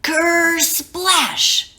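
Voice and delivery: high, punchy ker splash; splash sound. A narrator's reading. Narrator making the wave crash sound high. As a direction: make the splash sound big.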